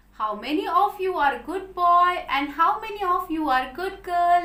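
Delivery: ordinary delivery, nothing marked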